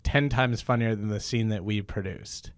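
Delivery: hammed-up emcee sign-off; host announcement. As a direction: address the audience like a comedy host. Announces end of show to audience.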